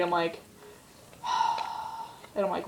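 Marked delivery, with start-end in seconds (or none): 1.2-2.1 long breathy sigh of reluctance; weary sigh. Retold or imitated. Asked by friend for a piggyback ride, weary sigh. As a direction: let out a put-upon sigh.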